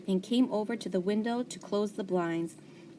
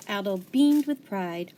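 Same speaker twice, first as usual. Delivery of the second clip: plain reading voice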